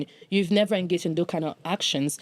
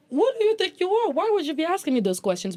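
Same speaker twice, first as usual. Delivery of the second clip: much higher, affronted retelling of her inner reaction; indignant retort; 11 semitones higher than usual. Retold or imitated. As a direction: snap back as if insulted, pitched far up. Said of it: "would have me like".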